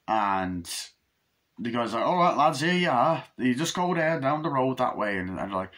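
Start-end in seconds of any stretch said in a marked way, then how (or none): 2.0-5.2 higher, lively character quote; hammed-up friendly countryman, pitched up; voiced local guy. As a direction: act out a chirpy local giving directions. Voices guy giving lads directions.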